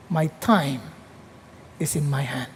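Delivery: a near-whisper of quiet assurance; hushed God voice. Retold or imitated. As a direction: speak it low and fading as God's promise. God's voice, closing point.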